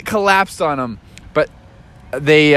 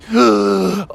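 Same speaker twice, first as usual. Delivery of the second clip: gasp for air; 3 semitones higher than usual. Acted. Surfacing after being underwater.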